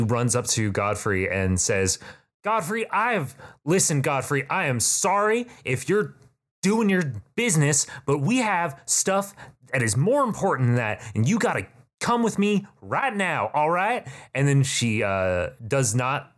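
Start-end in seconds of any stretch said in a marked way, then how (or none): 2.4-14.1 high, pressing voice of a soldier; a lightly voiced, urgent woman in armor; urgent warrior woman. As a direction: hurry him along like it's an emergency.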